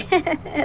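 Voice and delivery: soft little closing chuckle. Real, not acted. Host signing off after promising another reaction, soft chuckle. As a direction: chuckle softly to yourself.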